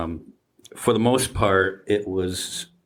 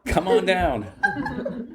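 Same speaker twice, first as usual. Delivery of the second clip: game show host; 12 semitones higher, much slower than usual. Pretend. Joining game show host bit.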